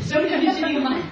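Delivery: high, fast squeaky cub through a laugh; baby bear. Acted. Baby bear tries the line again, high and giggly. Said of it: "little baby bears say".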